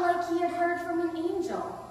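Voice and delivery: high, loud, breaking the news; excited report. Acted. First take, wondering simile.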